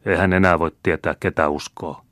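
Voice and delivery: plain reading voice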